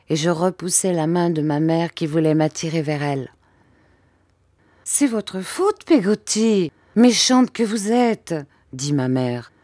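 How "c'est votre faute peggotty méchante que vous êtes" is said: reproachful mother. Acted. Mother scolds Peggotty.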